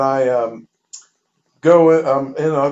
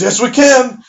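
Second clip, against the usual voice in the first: mock-emphatic, raised and high "yes, we can"; dad overruling his son, higher and louder; firm insistence; 8 semitones higher, 6 dB louder than usual. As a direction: insist over him, pitch up. Dad insists during the argument.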